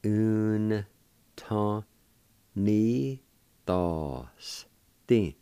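long, separated syllables with clear pauses; syllable-by-syllable demo. Shown as a demonstration. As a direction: teach the word one slow piece at a time. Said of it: "pronounce the N sound with the U like this".

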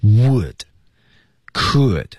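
"would" and "could" with the final d held back, unexploded; each word alone, falling, its final d swallowed; unreleased final consonant. Shown as a demonstration. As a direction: stop the d of "would" and "could" without releasing it. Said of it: "失去爆破的点跟前面是一模一样".

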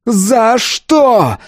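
high, drawn-out anguished cry. A narrator's reading. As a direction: cry it out so the street hears. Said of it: "Его крик был таким". (протяжный высокий крик)

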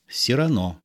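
plain reading voice

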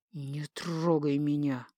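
slow, low, hushed menace; restrained threat, drawn out and soft; threatening hiss. A narrator's reading. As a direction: hiss it as a quiet warning. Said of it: "угрожающе шипит колдун".

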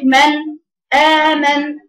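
emphatic detached hamza on "آمن"; separated hamza. Shown as a demonstration. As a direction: recite "من", cut, then strike "آمن" firmly. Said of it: "شوف بيفصل ازاي".

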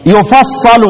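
يفصل said "yufassalu"; يفصل as "yu-fas-sa-lu"; doubled sad with a. Shown as a demonstration. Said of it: "والفتح ناخده منين؟".

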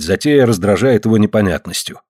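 ordinary delivery, nothing marked

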